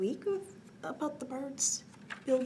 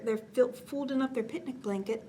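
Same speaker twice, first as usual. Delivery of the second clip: plain reading voice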